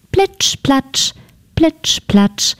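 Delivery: plitsch-platsch in separate wet plops; frog's wet steps, sounded out; splashing footsteps. A narrator's reading. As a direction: pitch it up and space out each splash.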